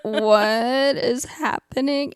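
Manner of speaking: slow, drawn-out confusion; slow bewildered. Retold or imitated. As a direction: stretch it out, baffled. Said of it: "And I was like".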